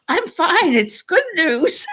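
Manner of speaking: slowed, laughing relief on the phone to sister-in-law; laughing through tears. Retold or imitated. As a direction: reassure her while giggling. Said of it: "And I says".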